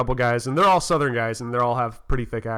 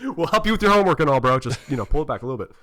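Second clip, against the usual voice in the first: fast; faster than usual. Retold or imitated.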